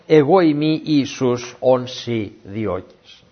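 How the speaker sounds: very slow, weighty declaration; solemn slow reply. Acted. Jesus answering Saul, slow and solemn. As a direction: let each word land slowly and gravely.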